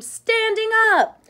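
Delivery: very high, loud, slow scream. A narrator's reading. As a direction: scream it as a furious demand. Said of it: "One side screamed".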